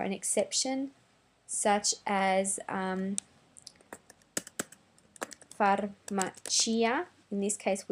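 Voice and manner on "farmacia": farmacia said slowly as far-ma-CHEE-a, i fully sounded; slow, careful farmacia with the i; say the final i. Shown as a demonstration. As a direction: farmacia with a stressed ee near the end. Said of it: "pronounce the I at the end".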